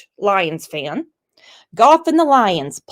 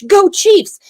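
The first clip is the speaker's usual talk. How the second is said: mock British accent; 10 semitones higher, 6 dB louder than usual. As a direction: Go Chiefs! in a playful put-on British accent, high and loud. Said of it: "in the Queen's English".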